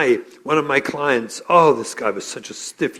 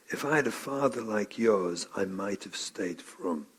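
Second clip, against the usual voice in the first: soft, emotional; 6 semitones lower, 10 dB quieter than usual. Retold or imitated. Tattoo artist tells the client's son, soft and emotional. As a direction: say it quietly, moved almost to tears. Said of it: "he gets emotional".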